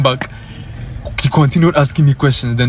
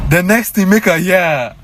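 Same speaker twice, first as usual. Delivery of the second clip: ordinary talk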